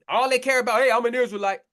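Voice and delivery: ordinary delivery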